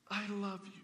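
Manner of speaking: soft, hushed I love you; tender confession. Pretend. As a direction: say it shyly and tenderly.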